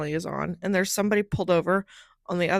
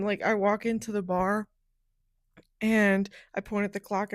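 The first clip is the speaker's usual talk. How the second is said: usual speaking voice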